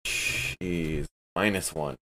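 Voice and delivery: normal talking voice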